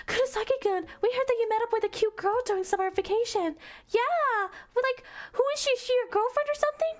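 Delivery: put-on very high squealing girl voice; gossipy girls. Classmates prying about his summer girl, squealy and high. Gaggle of girls questioning him.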